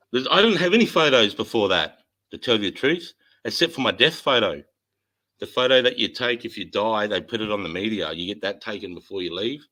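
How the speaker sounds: ordinary talk, nothing marked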